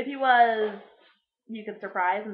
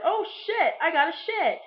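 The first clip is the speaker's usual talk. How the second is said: higher, sudden mock urgency; hammed-up surprise, pitched up; mock alarm; 5 semitones higher than usual. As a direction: play the trapped man caught short. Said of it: "the Japanese guy in the yard is like".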